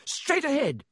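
high-pitched called-out shout. A narrator's reading. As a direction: call it out high, no extra volume. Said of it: "Harry shouted".

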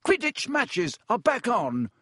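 high, slow excited roar. A narrator's reading. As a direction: roar it with glee. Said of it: "roared Wood excitedly".

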